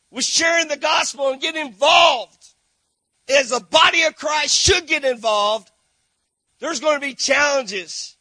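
loud, high-pitched pulpit call; rally the church to get involved; preaching at full force. Acted.

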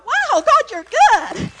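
high, loud burst of wonder; amazed praise. Retold or imitated. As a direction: cry out in joyful amazement to God. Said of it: "And I'm like".

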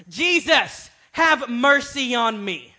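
loud, pleading cry, slow on the second line; shout for mercy. Retold or imitated. Blind beggar crying out to Jesus, loud pleading shout. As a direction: call out to Jesus over the crowd. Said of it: "he began to shout".

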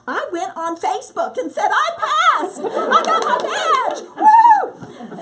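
hammed-up victory whoop through laughter; mock Facebook brag. Mock-bragging on Facebook, a squealing laughing victory whoop.

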